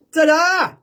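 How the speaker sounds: high, loud showman's reveal; big ta-da. Pretend. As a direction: belt out a triumphant ta-da. Bigger repeat of trick reveal.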